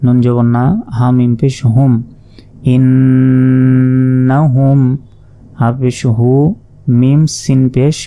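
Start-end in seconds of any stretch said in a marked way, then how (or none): 2.8-4.8 "ইন্নাহুম" slowly, with a long humming nn; recited with ghunnah. Shown as a demonstration. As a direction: hold the double n of "ইন্নাহুম" before moving on. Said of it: "ওয়াজিব গুন্না". ("ইন্নাহুম" গুন্নাহ করে ধীরে পড়া)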